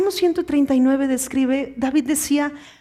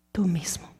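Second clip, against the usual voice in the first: whisper; 8 semitones lower, 9 dB quieter than usual. Acted. Preacher answering his own question, whispered. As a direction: answer in a hushed whisper, much softer.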